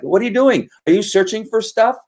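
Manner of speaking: needling questions in a put-on high voice; pestering bystander. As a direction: play a chatty onlooker pestering a dog handler. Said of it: "and I would kinda like".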